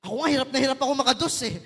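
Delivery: fast, loud, laughing quip; quick, loud laughing comeback; laughing joke. Retold or imitated. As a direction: toss off a laughing self-jab. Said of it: "Sabi ko".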